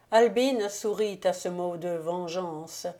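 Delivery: usual speaking voice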